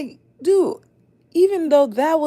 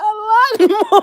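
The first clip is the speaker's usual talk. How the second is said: laughing; 14 semitones higher, 9 dB louder than usual. Real, not acted. Joking about third eye massage.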